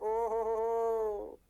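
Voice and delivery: long rolling "oho" of realisation; drawn-out "oho-ho". Acted. Teacher reacting to the photoelectric reversal, long drawn-out "oho". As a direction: exclaim a stretched-out "oho-ho-ho".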